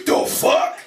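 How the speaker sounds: blunt, voiceless mock challenge. Customer to nail shop lady, blunt and incredulous. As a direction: throw it out as a curt challenge.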